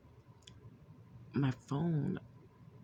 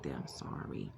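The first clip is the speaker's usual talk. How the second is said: low, soft, crying apology to her dad; tearful apology; 12 semitones lower, 4 dB quieter than usual. Retold or imitated. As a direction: sob out sorry, low and quiet. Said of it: "I was crying".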